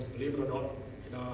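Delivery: ordinary talk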